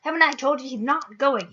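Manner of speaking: tight, furious hiss at the giant. A narrator's reading. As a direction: spit the words out angrily. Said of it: "he hissed".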